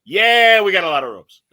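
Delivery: loud, high mock Chico Marx Italian accent; hammed-up Chico Marx hotel clerk; Chico Marx impression. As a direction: boom the yeah like a vaudeville Chico Marx. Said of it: "that Chico Marx sort of went".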